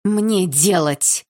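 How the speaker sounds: slow, drawn-out near growl; almost growling. A narrator's reading. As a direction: force it out, losing patience. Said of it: "почти прорычала я".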